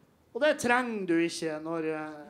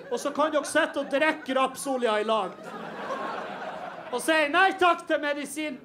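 retold angry rant; 5 semitones higher than usual. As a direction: let rip at the troll, half joking. Retold furious reply to troll.

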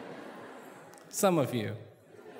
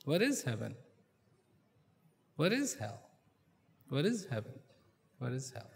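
musing to himself, fading; 3 semitones lower, 6 dB quieter than usual. A narrator's reading. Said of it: "kept thinking".

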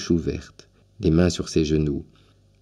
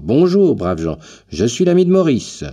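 loud, much higher hearty greeting; calling out loud; 8 semitones higher, 6 dB louder than usual. A narrator's reading. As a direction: burst in with a big cheerful hello. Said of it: "en criant bien fort".

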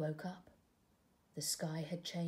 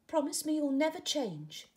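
higher and louder storybook caterpillar; caterpillar's longing; 8 semitones higher, 5 dB louder than usual. Acted. Caterpillar to tadpole, yearning, higher and louder. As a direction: ask him to promise again.

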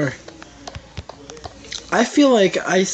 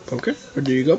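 ordinary talk, nothing marked

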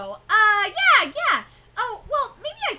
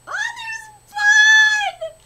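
high, loud, very slow drawn-out scream; fangirl scream; 13 semitones higher, 9 dB louder, much slower than usual. Real, not acted. Fan screaming as her favourite appears, excited. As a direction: scream out the name in delight.

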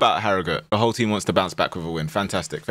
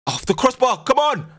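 excited shout; 14 semitones higher than usual. Real, not acted. Near miss in match.

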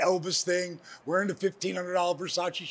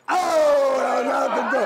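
loud laughing shout, higher; 7 semitones higher, 8 dB louder than usual. Real, not acted. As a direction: crack up loudly at the punchline. Laughing at his own punchline.

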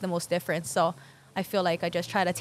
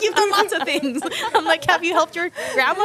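high, louder, laughing and quick; laughing encouragement; 9 semitones higher, 6 dB louder than usual. Retold or imitated. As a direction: cheer him on through laughter. Coaching kid, laughing.

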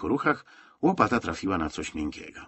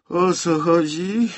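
slow, high, slurred just-woken voice; groggy soldier woken from his bunk; sleepy mumble; 10 semitones higher, 7 dB louder, slower than usual. A narrator's reading. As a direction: mumble it half asleep, dragging the words. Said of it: "bełkotał zaspany Góral".

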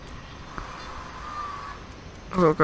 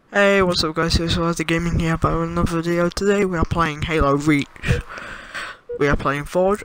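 normal talking voice

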